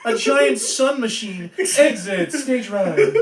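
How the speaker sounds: Snagglepuss voice. Retold or imitated. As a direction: ham up a cartoon lion exit.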